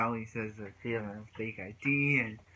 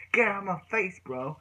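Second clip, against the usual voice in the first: higher and louder, retelling Brandon's snap; a hammed-up shove-off line; mock angry outburst; 8 semitones higher, 4 dB louder than usual. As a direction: throw it back like an angry teen. Brandon snapping in retold fight.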